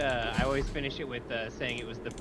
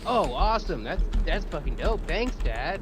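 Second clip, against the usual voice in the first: louder, higher thrilled thanks; loud delighted teen; excited son; 6 semitones higher, 5 dB louder than usual. Retold or imitated. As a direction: jump at the gift. Son thrilled by birthday money.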